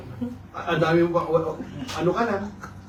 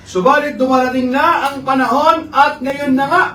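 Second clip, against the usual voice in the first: scripture reading; 7 semitones higher, 6 dB louder than usual. Acted. Preacher reading scripture verse aloud.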